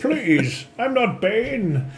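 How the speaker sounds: high, stretched-out character plea; Deckard Cain. Acted. Streamer's game-character impression, protesting in a slow high wobble. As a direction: silly voice that sounds like Bane. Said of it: "He sounds a lot like Bane".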